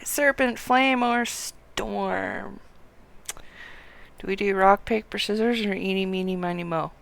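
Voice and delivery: normal talking voice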